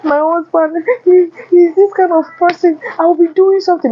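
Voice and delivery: mimicking a married woman griping about her husband; mocking wife. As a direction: put on a high complaining woman's voice. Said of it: "most times she will say".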